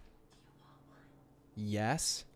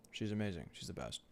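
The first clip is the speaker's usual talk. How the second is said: chewing while talking; 3 semitones lower, 8 dB quieter than usual. Real, not acted. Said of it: "Sorry, I'm chewing on stream".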